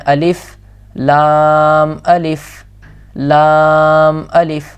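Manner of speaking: لام stretched long, الف short; drawn-out letter names for repetition; slow recitation. Shown as a demonstration. Teacher showing how long to hold لام.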